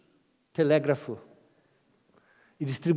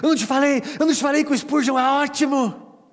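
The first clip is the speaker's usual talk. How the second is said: much higher, loud triumphant nudge; proud told-you-so; 11 semitones higher, 8 dB louder than usual. Retold or imitated. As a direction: gloat proudly to a friend. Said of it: "cutucou o amigo e disse assim". (amigo orgulhoso cutucando, bem agudo e alto)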